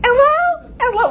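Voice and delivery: squeaky, very high attempt at British; bad British accent. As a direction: do a hopelessly high-pitched British hello. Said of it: "That was my British accent".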